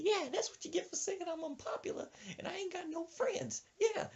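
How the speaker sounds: high falsetto scolding, softened; vengeful old woman. Retold or imitated. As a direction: gloat in a squeaky old-lady voice. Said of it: "She said".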